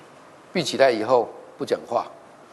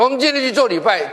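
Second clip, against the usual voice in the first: retold declaration to the family, pitched higher and louder; loud announcement; 11 semitones higher, 7 dB louder than usual. Husband firmly announcing church to his quarrelling family, loud. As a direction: call it out firmly to the whole house.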